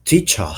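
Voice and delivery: "teacher" ending in "ah", no R; British non-rhotic R. Shown as a demonstration. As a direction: drop the R of "teacher" for an ah. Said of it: "the R at the end of words isn't pronounced".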